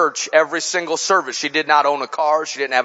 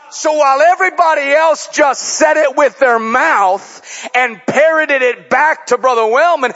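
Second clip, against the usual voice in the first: raised, driving preacher's voice; preaching at full force; 7 semitones higher, 5 dB louder than usual. Acted. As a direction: preach it out to the whole church. Preacher driving the story home.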